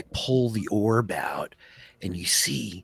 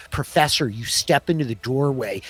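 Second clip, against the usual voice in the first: ordinary talk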